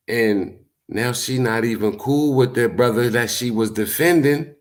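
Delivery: normal talking voice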